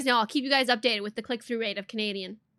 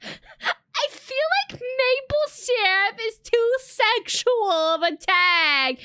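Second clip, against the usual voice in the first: high, drawn-out laughing wail; mock-distressed protest; 10 semitones higher, 3 dB louder, much slower than usual. Streamer rejecting chat's tag suggestion, mock-distressed protest. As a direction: wail it in slow, comic dismay.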